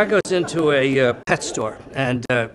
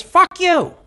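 very high, loud parrot voice; swearing parrot; 7 dB louder than usual. Acted. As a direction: curse like a parrot, pitched right up and loud. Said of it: "The parrot says".

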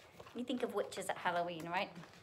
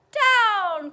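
high, very loud drawn shout; witch's cry; 13 semitones higher, 16 dB louder than usual. A narrator's reading. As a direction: bellow down as the witch. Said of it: "cried the witch".

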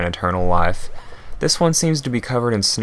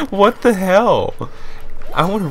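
baffled; 11 semitones higher, 3 dB louder than usual. Real, not acted. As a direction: stretch it out in total bewilderment. Baffled by the banana line.

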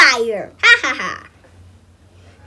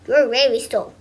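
usual speaking voice

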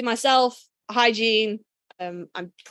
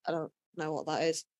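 quiet; 7 semitones lower, 12 dB quieter than usual. Retold or imitated. Confused newcomer quoted.

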